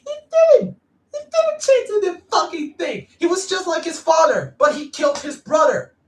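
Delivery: high-pitched, loud, indignant rant; exasperated outburst. Real, not acted. Fan ranting about the villain who killed his brother, exasperated outburst. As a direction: shout it out in high-pitched outrage.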